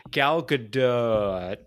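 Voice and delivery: Gal Gadot, slow and drawn out; "Gal Gadot" said very slowly; careful pronunciation. Real, not acted. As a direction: stretch out "Gal Gadot" carefully, holding the last syllable. Careful go at a disputed name.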